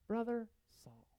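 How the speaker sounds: slow, soft Brother Saul; gentle greeting. Retold or imitated. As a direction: say it as gently as you can. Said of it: "that just so gently says".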